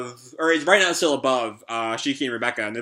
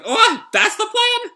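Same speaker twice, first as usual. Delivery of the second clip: very high, drawn-out squeaky disbelief; startled question; 4 dB louder, slower than usual. Acted. Happy reacting to the plan, startled squeaky question. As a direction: squeal it in hammed-up astonishment.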